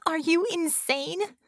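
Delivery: high, slow disbelief; incredulous. Acted. Bella doubts Bull's sanity, incredulous. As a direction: stretch the question out in astonishment. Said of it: "Bella said incredulously".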